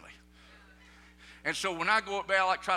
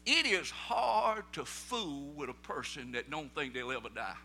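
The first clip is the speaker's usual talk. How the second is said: usual speaking voice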